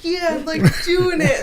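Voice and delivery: loud, high and laughing with pride; mock-triumphant cheer through a laugh; cocky excitement. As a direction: brag it like you just won big. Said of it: "so I was like".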